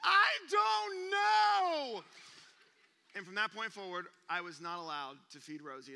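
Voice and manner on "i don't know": high, loud, slow wail; helpless crying shout. Retold or imitated. Dad covered in milk answering his wife, crying shout. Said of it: "And I'm sitting here".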